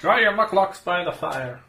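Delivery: high, loud hospitable host; jolly innkeeper. Acted. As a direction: bustle them toward the fire. Innkeeper voiced.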